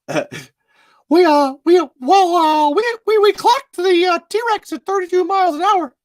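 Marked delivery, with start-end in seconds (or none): 1.1-6.0 very high squeaky falsetto mouse; Mickey Mouse. Acted. Park host greeting visitors to Jurassic Park, Mickey Mouse voice. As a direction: pipe it up high and loud, like a cartoon mouse. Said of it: "Mickey Mouse welcoming you to Jurassic Park".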